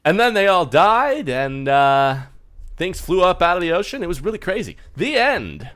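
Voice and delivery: high, loud put-on voice; theatrical, slapdash wrap-up of a tale; strange narrator fellow. Strange fellow tells audience the ending, high stagey voice.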